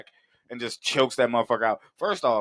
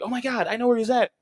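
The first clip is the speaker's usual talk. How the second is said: Gwen excited; 10 semitones higher than usual. Retold or imitated. Gwen's realization.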